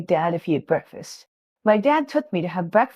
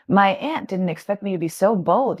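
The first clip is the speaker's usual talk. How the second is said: ordinary delivery, nothing marked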